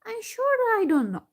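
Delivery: the forgetful gnome stammering high and confused. A narrator's reading. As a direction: trip over the words, lost. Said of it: "he stammered".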